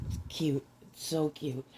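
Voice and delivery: usual speaking voice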